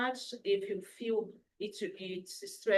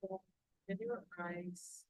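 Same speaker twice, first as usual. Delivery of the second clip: calm breathing guide, unhurried; guided meditation; 5 dB quieter than usual. Acted. Leads a guided breathing relaxation.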